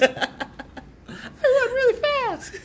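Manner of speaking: very high, slow falsetto; drawn-out squeaky mock hero voice. Co-host demonstrating Flash's disguise voice, high and girly. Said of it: "much higher, girlier voice".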